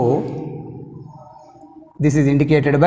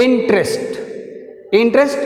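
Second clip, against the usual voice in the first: "Interest" with a firm IN; teacher modelling "Interest", stressed at the start; first-syllable stress; 4 semitones higher, slower than usual. Shown as a demonstration. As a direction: push the IN of "Interest". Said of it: "stress mark I".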